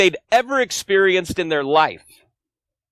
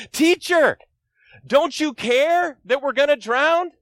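desperate cry; 7 semitones higher than usual. Acted. Pastor voices the disciples crying out to Jesus, high and pleading. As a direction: beg like a man in a sinking boat. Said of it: "you see them shouting".

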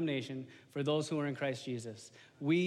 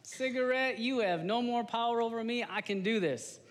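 confident self-talk; 7 semitones higher than usual. Retold or imitated. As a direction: announce victory over the cigarette, pumped up. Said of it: "and I'm going to be able to overcome".

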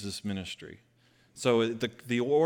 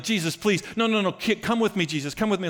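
higher, louder desperate begging; groveling plea; 8 semitones higher, 4 dB louder than usual. Pretend. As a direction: beg him on your knees to come along.

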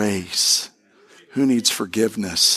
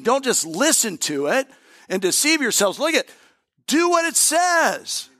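much higher, driving scripture read; impassioned pulpit reading; fervent preaching; 8 semitones higher than usual. Acted. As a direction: lift the room with the verse, pitch climbing. Preaches scripture at full force.

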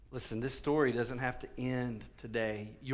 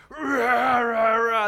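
loud, high wordless roar; self-parodying pulpit bellow; mock preacher ranting; 10 semitones higher, 12 dB louder, much slower than usual. Shown as a demonstration. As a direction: roar nonsense like a worked-up preacher. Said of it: "see that from your preacher on Sunday".

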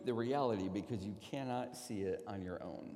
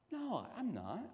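defensive denial; 7 semitones higher, 3 dB quieter than usual. Retold or imitated. Retorting to wife's accusation.

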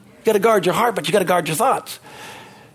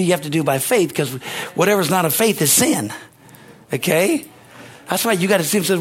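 ordinary talk, nothing marked

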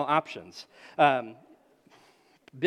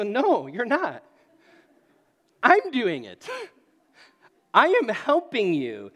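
slow, louder firm parent voice; stern dad insisting; 5 semitones higher, 8 dB louder, slower than usual. Retold or imitated. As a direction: hold your ground with a stubborn toddler. Dad arguing with toddler on ice.

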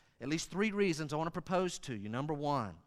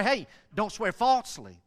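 "falsely" driven home, raised voice; loud, higher warning stressing FALSELY; 5 semitones higher, 6 dB louder than usual. Shown as a demonstration. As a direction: hit "falsely" as the key word. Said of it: "I think the emphasis there".

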